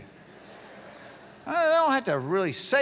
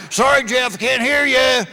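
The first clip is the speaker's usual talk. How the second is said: loud, mock innocent; teasing friend; 5 semitones higher, 9 dB louder than usual. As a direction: play dumb to tease him. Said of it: "friends would love to leave you".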